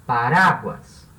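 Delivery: "paráguas" drawn out slowly on "rá"; slow "paráguas", strong stretched "rá"; stress on RA. Shown as a demonstration. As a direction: lean hard on the middle syllable of "paráguas". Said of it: "A sílaba tônica é ra".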